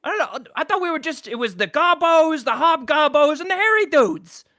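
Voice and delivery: high, loud goblin grumble; indignant goblin. Acted. Goblin guard objecting to working with humans, high and loud. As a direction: complain like a peeved goblin.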